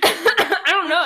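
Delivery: high, slow, giggling it out; laughing. Real, not acted. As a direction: laugh through the words. Laughs at own story.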